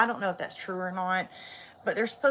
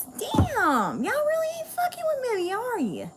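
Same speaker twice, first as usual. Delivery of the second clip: much higher, squeaky mock-hurt whine; mock squeaky complaint. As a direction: pout it at viewers in a squeak. Complaining to viewers about few likes.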